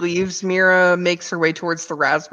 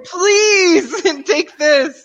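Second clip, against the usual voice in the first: high, loud imploring cry; pleading; 10 semitones higher, 5 dB louder, much slower than usual. Acted. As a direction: beg with one stretched, pitched-up word. Urging captive to take raisin.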